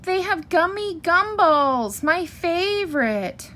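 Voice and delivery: high-pitched, slow whiny plea; whining. A narrator's reading. Sister bear begging Mama for gumballs, whining. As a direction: draw it out in a high child's whine. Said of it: "whined Sister".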